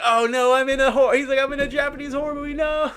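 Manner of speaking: high, loud mock wail; mock horror panic. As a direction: wail it like a doomed movie victim, hammed up. Mock panic of movie character.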